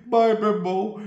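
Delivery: high put-on goodbye; imitated farewell. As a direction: imitate the other man calling goodbye, pitch up. Beaten man's farewell, imitated.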